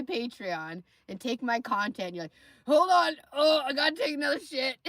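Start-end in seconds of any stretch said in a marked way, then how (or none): 2.7-4.8 loud, high, laughing mock voice; mock urgent. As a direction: shout it like a panicked buffoon. Mocking David mid-stream.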